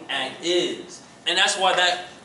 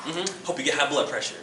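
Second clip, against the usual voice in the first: passive aggressive; 2 semitones higher, 4 dB louder than usual. Shown as a demonstration. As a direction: pass the salt with fake politeness. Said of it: "And that passive aggressive".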